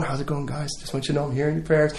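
soft, low, offhand murmur; casual hushed aside. Acted. Jesus leans on someone praying, hushed casual reassurance. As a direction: drop the voice as if leaning on a friend.

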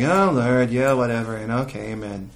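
low, indifferent praying, fading away; acted bored, trailing-off amen; mock half-hearted prayer. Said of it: "turn to you that way".

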